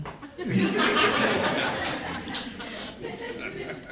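airy, unvoiced high laugh; breathless laugh. Real, not acted. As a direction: laugh silently at your own story. Laughs at her own story.

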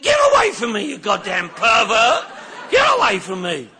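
mock outraged yell; shouting him off. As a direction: yell at him to get away. Shoving off a hugging friend.

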